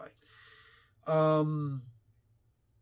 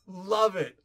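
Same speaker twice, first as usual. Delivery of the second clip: louder love it; 4 semitones higher, 7 dB louder than usual. Real, not acted. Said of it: "repeats reaction".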